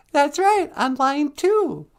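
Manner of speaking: squeaky, slow announcing; high kid voice. Acted. Child announcing the caller, high and slow. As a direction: play the kid pointing to the line.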